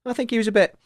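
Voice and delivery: ordinary delivery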